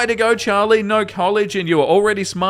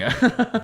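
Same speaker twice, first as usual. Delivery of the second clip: short high laugh; incredulous giggle; real laugh; 6 semitones higher than usual. Real, not acted. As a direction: burst out laughing in disbelief. Laughs again in disbelief.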